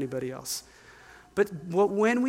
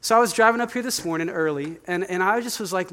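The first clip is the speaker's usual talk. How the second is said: normal talking voice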